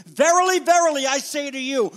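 "Verily, verily" belted out loud and high; booming verily. Shown as a demonstration. Preacher dramatizing Jesus's emphasis to the congregation, booming. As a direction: proclaim "Verily, verily" as if it must be underlined. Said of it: "get out your highlighter".

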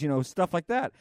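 usual speaking voice

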